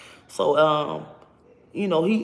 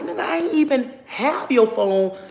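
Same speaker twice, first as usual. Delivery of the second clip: angry retort; 7 semitones higher, 3 dB louder than usual. Retold or imitated.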